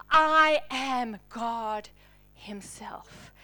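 very slow, fading to a whisper on himself; slow weighty declaration. Acted. Preacher declaring Jesus' identity, slow and hushed. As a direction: drop the voice on the final word.

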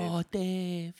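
high, soft, bashful voice; shy, nervous fan. Acted. Shy Dalai Lama meeting Dave, high soft voice. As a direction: coo it high and softly, star-struck. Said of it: "remember that he's very shy".

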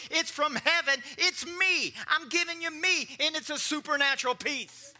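loud, high, rousing sermon voice; full-force preaching. Acted. Preacher rousing the church about peace, loud preaching. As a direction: lift the room, preach it hard.

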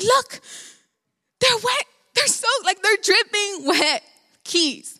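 high-pitched retold outburst at mom; angry retelling. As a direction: replay an indignant complaint, pitched high. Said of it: "I was so angry".

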